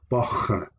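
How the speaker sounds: bocha with a throaty fricative ch in the middle; fricative sound. Shown as a demonstration. Teacher demonstrating the Old English word for bow, fricative ch. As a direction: say bocha with a rasped ch. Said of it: "it has the fricative sound".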